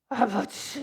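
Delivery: loud anguished cry; crying out Father. Retold or imitated. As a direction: wail it out like a grieving mother. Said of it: "뭐라고 그러냐면".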